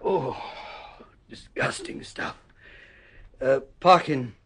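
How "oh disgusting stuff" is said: whispered aside, pulling a face; soft, grimacing whisper; disgusted aside. Acted. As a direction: mutter about the foul taste. Grimacing after his medicine.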